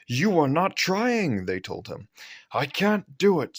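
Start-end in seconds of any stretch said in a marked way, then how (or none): 0.1-1.4 severe. A narrator's reading. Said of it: "talking to him severely". 2.5-3.6 complaining engine. A narrator's reading. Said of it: "said Gordon".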